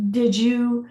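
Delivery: ordinary delivery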